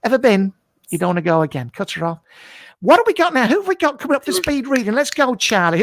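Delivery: ordinary talk, nothing marked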